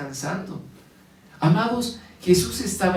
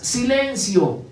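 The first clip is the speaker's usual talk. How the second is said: higher, commanding call to the storm; raised, firm command to the waves; stern rebuke; 6 semitones higher, much slower than usual. A narrator's reading. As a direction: order the wind to be still. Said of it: "reprendió al viento y dijo a las olas".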